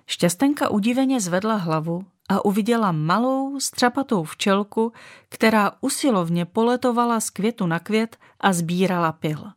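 ordinary delivery, nothing marked

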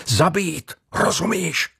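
high, strained, pressing demand; insistent command. A narrator's reading. Ship's carpenter grabs Jean's sleeve, insisting in a high, urgent voice. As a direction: press it on him, pitched high. Assailant presses boy to kill.

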